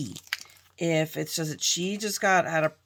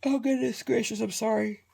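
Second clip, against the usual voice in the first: yawning; 4 semitones higher than usual. Real, not acted. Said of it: "I'm yawning your faces".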